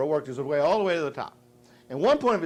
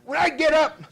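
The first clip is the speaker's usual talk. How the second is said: high, loud mock threat; imitating angry father; 14 semitones higher, 9 dB louder than usual. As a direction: holler it like a furious dad. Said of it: "he'd go".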